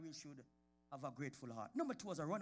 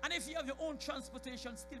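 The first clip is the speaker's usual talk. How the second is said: raised preaching; 8 semitones higher than usual. Real, not acted. Preacher raising voice.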